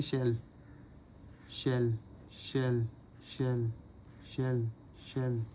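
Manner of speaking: soft repeated शैल; drilled shall. Shown as a demonstration. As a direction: say शैल softly while writing. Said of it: "ऐसे बना देंगे".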